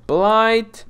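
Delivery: sudden high, loud cry of dismay; loud high-pitched groan of dismay; annoyed outburst. Real, not acted. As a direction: spit out the bad news. Blight appears on crops.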